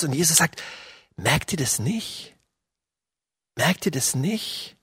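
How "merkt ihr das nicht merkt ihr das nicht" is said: soft and slow, gently pressing the disciples; quiet, slow question. Acted. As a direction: let the question sink in quietly. Jesus quietly asks his disciples. (leise, langsam eindringliche Frage)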